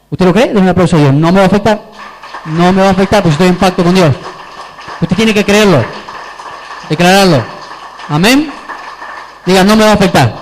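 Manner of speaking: proclamación muy fuerte a la congregación; prédica a toda fuerza. Real, not acted. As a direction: arenga a los fieles a viva voz. (declarar con fervor, casi a gritos)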